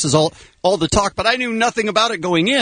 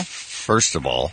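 slow, much lower mock stoner; mocking slow low imitation; 6 dB quieter, much slower than usual. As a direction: drag it out in a low parody. Host mocks Carl's line slow and low.

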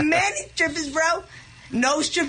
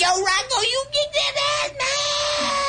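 cheering on; 10 semitones higher than usual. Retold or imitated. Drugged patient cheering his buddy Rocco in a fight, rising yell. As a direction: voice climbs high into a yelled cheer. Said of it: "And I was like".

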